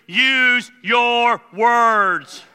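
loud, high, emphatic scolding; forceful orders with the first words stretched out; exasperated God. Acted. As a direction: lay down the law like a fed-up parent. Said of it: "God looks down and says".

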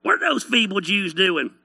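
jeering hillbilly twang, livelier; hillbilly mocker. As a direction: scoff in a hillbilly accent. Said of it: "I just picture them as a bunch of inbred hillbillies".

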